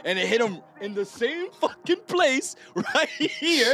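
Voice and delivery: high, slow words through laughter; can barely get it out for laughing. Real, not acted. Climax of rock story, laughing.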